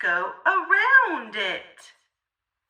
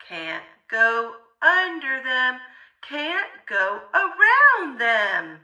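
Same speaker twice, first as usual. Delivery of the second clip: ordinary delivery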